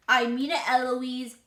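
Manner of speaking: very loud parroting; child mimicking tutor. A narrator's reading. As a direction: fire the warning right back, loudly. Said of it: "And I say".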